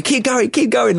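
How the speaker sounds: excited high egging on; mock cheer to keep driving. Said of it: "I'm going".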